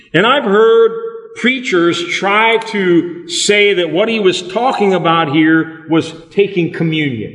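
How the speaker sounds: ordinary talk, nothing marked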